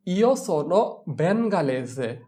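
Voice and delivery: very slow, careful; slow model answer. Shown as a demonstration. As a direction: drill it slowly. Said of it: "teacher models answer".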